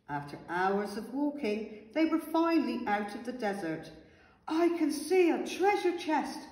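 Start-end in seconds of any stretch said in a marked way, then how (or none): 4.5-6.4 high, delighted call; excited shout. A narrator's reading. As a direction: call out a thrilling discovery. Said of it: "shouted Carrot".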